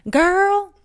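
high, loud Southern sass imitation; Southern twang. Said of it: "always try to do the Southern twang".

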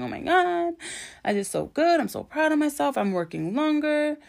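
high-pitched, giddy self-congratulation; elated. Retold or imitated. Driver thrilled on the way home, elated. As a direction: gush with pride, pitched high. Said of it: "on cloud".

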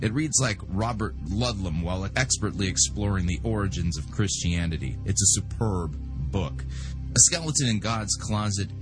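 ordinary talk, nothing marked

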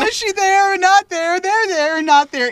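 high, loud, slow mock panic. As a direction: wail the question in fake alarm. Mock-panicked Willow quote.

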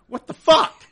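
high, loud exasperated yell; loud outburst. Acted. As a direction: yell it in disbelief. Outburst at lottery numbers.

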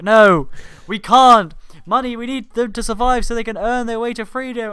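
high, loud sidekick pleading; sidekick protest. Retold or imitated. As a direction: squeal it like a panicked sidekick. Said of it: "and Penfold's like".

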